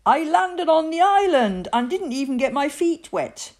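put-on high, loud, proud little boy; excited boyish bragging; boastful boy. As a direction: crow about the feat. Victor boasts of his landing.

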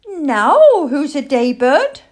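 high, loud, drawn-out little owl challenge; young owl yelling high and slow; defiant shout. A narrator's reading. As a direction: shout it out into the darkness. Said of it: "Plop shouted at the darkness".

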